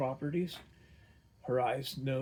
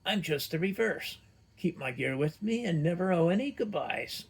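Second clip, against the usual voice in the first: higher, louder playful retort; quick witty riposte; 6 semitones higher, 4 dB louder than usual. Acted. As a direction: fire it back like a fencing thrust. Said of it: "almost as a fencing riposte".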